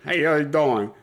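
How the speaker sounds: hammed-up Brooklyn "how you doing"; Brooklyn accent. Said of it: "The Brooklyn accent's back".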